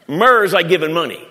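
loud, high fiery preaching; preaching at full force. Acted. Preacher booming to the congregation, fiery. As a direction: preach it out to the crowd.

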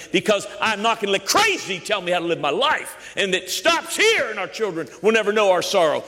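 high, forceful rallying declaration; impassioned preaching. Real, not acted. Preacher vows crazy stops with this family, high impassioned preaching. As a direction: preach it as a defiant vow.

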